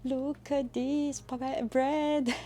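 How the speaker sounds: high, slow, gleeful reveal; excited showing off. Real, not acted. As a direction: show off the bread with glee. Proudly shows the bread.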